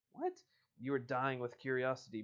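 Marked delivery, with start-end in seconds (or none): 0.1-0.4 high startled question; surprised what. Real, not acted. Surprised reaction.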